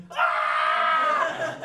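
loud, very high-pitched scream; hammed-up shriek of a panicked caller. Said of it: "I was just screaming and yelling".

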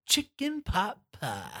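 drawn-out game-show reveal; host impression. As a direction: announce the prize like a hammy TV host. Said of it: "What are we playing for, Jeff".

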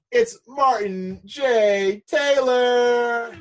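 slow, loud ring-announcer call, pitched high; announcer guest intro. Acted. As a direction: stretch the name out like a boxing announcer. Host announces the guest's name.

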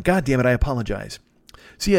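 usual speaking voice

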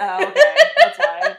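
very loud, high-pitched laughing reply. Real, not acted. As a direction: burst out laughing on the words. Cracking up at the co-host's explanation.

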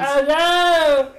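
high sharp cry of alarm; shocked. Real, not acted. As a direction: yelp it like bad news just landed. Player reacts to assassin attack.

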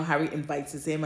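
ordinary talk

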